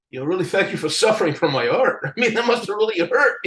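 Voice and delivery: quick, chuckling quip; laughing joke. Retold or imitated. Thanking a tattooed fan, a fast laughing joke. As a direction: tease the fan while laughing.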